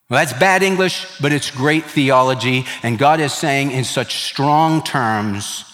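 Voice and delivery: ordinary talk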